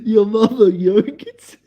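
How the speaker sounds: cracking up mid-words; words squeezed out through laughter; laughing in disbelief. Real, not acted.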